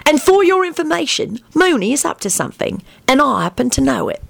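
high-pitched haughty insistence; self-important and haughty. A narrator's reading. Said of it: "Sirius said haughtily".

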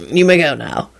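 plain reading voice

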